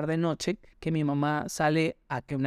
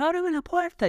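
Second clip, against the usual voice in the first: much higher mock angry order, ábreme hit hard; imitating an angry mother, pitched way up; 12 semitones higher than usual. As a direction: snap the command like a furious mother. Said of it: "estaba súper enojada y me dice".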